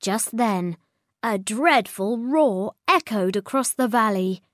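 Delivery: plain reading voice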